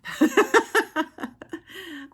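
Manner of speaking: a loud, breathy real laugh trailing down; breathless laugh. Real, not acted. Laughing after admitting breathlessness.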